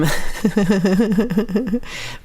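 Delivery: long high giggle. Real, not acted. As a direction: giggle in a rapid run. Long laugh on its own.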